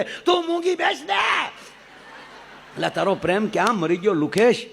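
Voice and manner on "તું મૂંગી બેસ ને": high, slow mock shout; hammed-up husband yelling at his wife. Husband tells wife to shut up, high mock shout.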